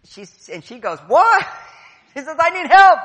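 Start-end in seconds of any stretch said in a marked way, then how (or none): 1.1-1.5 much higher, much louder mock outrage; shrill indignant what. Mimicking the wife's outraged, much higher and louder what. Wife reacting, speaker mimics her. 2.4-3.0 much higher, louder call for help; hollered plea across the yard; mock cry for help. Mimicking the wife calling to the men, loud and high.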